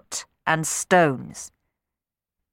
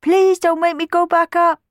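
put-on higher, louder pleading child voice; begging boy; 13 semitones higher, 6 dB louder than usual. Sammy begging the children again, higher pleading. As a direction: beg desperately like a frightened child. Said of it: "begged Sammy again".

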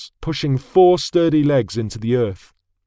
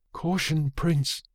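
soft, higher-pitched caution; hushed warning; 3 semitones higher, 8 dB quieter, slower than usual. A narrator's reading. Soldier warns prince without spooking the steed, hushed. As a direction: warn without raising the voice. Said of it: "a soldier warned, not raising his voice".

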